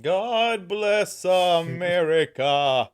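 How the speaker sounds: drawn-out, loud and high; slow patriotic proclamation. Pretend. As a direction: proclaim it very slowly, grandly. Patriotic sign-off.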